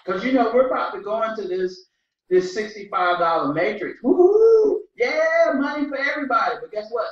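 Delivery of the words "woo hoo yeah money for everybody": high, loud woo-hoo; excited cheer. Real, not acted. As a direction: cheer it out with joy. Cheering about the new matrix.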